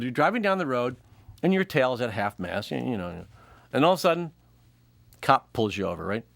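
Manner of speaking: ordinary talk, nothing marked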